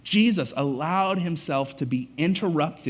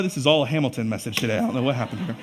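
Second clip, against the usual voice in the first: ordinary delivery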